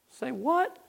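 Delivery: soft, high-pitched rising question; baffled disbelief. Retold or imitated. Preacher re-enacting his baffled reply to his friend, incredulous. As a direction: let it lift sharply in puzzlement.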